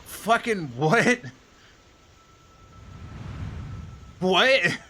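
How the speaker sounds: drawn-out, high, loud disbelief; incredulous. Retold or imitated. Clerk reacting to customer's shipping advice, incredulous. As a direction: reenact being utterly baffled, pitch way up.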